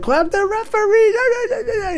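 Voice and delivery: very high, drawn-out wail, laughing through it; hammed-up sobbing shout; crying outburst. Mali on the bench after the red card, mock wailing. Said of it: "he's like".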